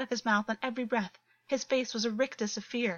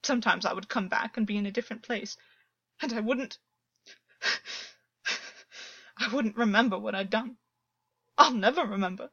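distraught; 3 dB louder than usual. A narrator's reading. Distraught, half-hysterical confession.

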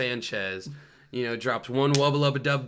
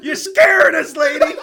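high shrieking mock fear; scared cry; 12 dB louder than usual. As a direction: wail it like a terrified kid. Mock fright at lunch lady.

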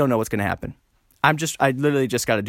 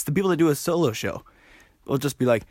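ordinary delivery

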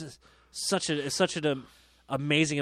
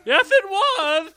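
teasing singsong; 9 dB louder, much slower than usual. Pretend.

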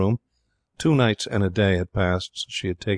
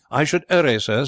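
high, louder respectful butler's suggestion; 7 semitones higher, 4 dB louder than usual. Acted. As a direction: urge him on politely as a servant. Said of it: "suggested Keggs respectfully".

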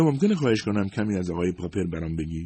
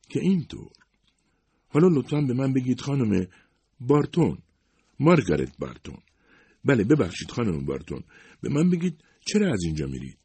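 a higher, curious questioning voice; lightly voiced Miss Smart; 7 semitones higher, 3 dB louder than usual. A narrator's reading. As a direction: play the probing young interviewer. (صدای کمی زیرتر دوشیزه اسمارت، کنجکاو و پرسشگر)

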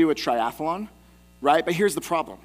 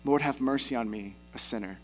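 low, subdued plea; humble tax collector; 6 semitones lower, 6 dB quieter than usual. Acted. Tax collector begging God for mercy, hushed and humble. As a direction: drop low and soft, trailing off humbly. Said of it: "the tax collector just says".

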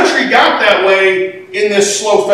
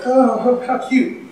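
mock cooing; 6 dB quieter, slower than usual. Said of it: "we read that scene and we go".

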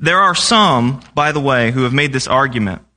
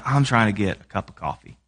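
grumpy mumble; 5 semitones lower, 6 dB quieter than usual. Retold or imitated. Grumpy self answering wife.

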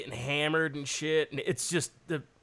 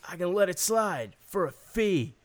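sly dopey offer; 6 semitones higher, 8 dB louder than usual. Retold or imitated. Supervisor offering to let the porn slide, sly and higher. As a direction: dangle the deal slyly. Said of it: "He's like".

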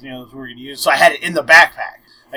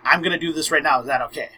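retold whisper; 7 dB quieter, faster than usual. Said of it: "Like, I whisper to her".

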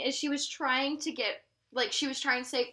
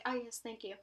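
mystic fortune teller; 3 semitones lower, 13 dB quieter than usual. Retold or imitated. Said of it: "rub and be like".

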